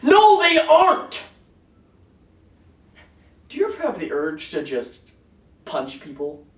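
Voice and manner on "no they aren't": slow, very high, loud contradiction; outraged shout. Acted. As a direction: shout it back, stretching every word. Acted quarrel over fresh nuggets.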